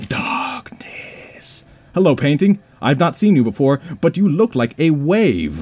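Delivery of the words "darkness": eerie whispered echo. Acted. Player echoing the dream text in a creepy whisper. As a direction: breathe the word like a ghost.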